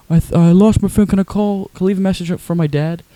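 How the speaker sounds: high, halting mimicry; pleading request in a put-on high voice; Tim impression. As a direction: imitate his odd, almost deaf-sounding speech. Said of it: "he talks like this".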